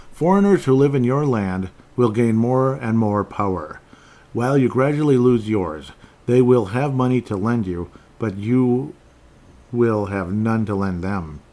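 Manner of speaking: emphatic, measured Bible reading; scripture reading. Acted. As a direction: read the verse out firmly, weighting each phrase. Reads a Bible verse aloud.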